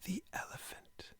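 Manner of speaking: muffled breathy whisper behind a hand; hidden whisper. Acted. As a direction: slip the words out so no one hears. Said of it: "put his hand in front of his mouth".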